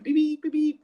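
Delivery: put-on high, piping beep beep like an old phone; imitated text beeps. Footballer mimics the manager's phone going off with text replies, high beeping. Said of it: "the old text messages coming back".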